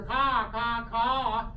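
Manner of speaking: high, loud cawing; crow caw imitation. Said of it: "When you hear".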